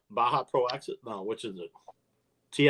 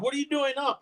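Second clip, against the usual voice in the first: put-on New Zealand accent; 12 semitones higher, faster than usual. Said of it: "He's like".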